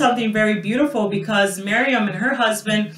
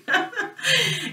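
laughs; 10 semitones higher, 6 dB quieter than usual. Real, not acted. Laugh after mock quote.

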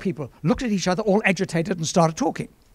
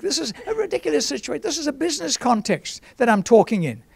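high-pitched mock objection; imitated scoffing; 9 semitones higher than usual. Doubter objecting in a business setting, imitated high-pitched scoffing. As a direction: voice a doubter's protest, pitched right up. Acts out a doubting listener's thought.

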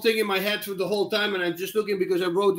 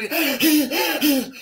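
mock gasps, higher pitched; imitated gasping; 7 semitones higher, 3 dB louder than usual. Fighter retells his downed opponent's struggle, imitated gasping. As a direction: gasp like a downed fighter, hammed up.

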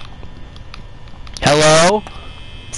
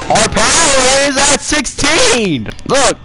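high, drawn-out panicked call; hammed-up panic, pitched high; played-up alarm; 7 semitones higher, slower than usual. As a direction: raise the alarm, stretching the words. Game power running out, alarm.

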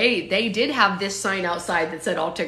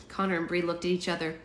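ordinary delivery

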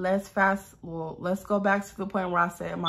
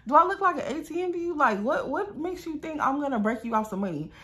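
indignant retort; 7 semitones higher than usual. Retold or imitated. Acted indignant refusal continues.

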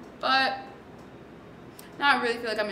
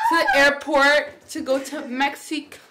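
excited; 8 semitones higher, 10 dB louder, slower than usual. Real, not acted. Excitedly announcing the trip.

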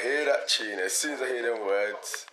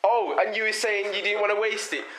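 ordinary delivery

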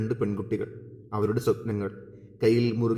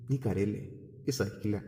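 a touch of a voice catching with tears; soft and lightly breaking; faltering voice; 7 dB quieter than usual. A narrator's reading. Friend comforting Lekha as she cries, a mild catch in the voice. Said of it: "അവൾ ഇടറിയ സ്വരത്തിൽ പറഞ്ഞു".

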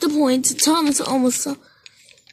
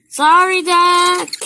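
loud apology; 6 semitones higher, 7 dB louder, slower than usual. A narrator's reading. Engine apologising in story.

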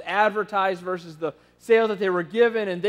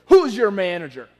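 angry demand; 8 dB louder than usual. Retold or imitated. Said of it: "kind of stomps up to him".

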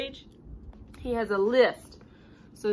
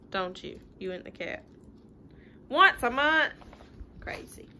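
normal talking voice

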